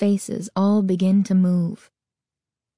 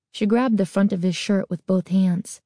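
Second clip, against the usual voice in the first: ordinary delivery, nothing marked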